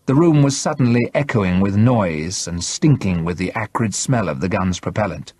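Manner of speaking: plain reading voice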